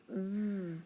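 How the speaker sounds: V as a held buzzing vvv; V said as a sustained vvv; letter sound. Shown as a demonstration. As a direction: buzz the V sound, not its name. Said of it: "what about".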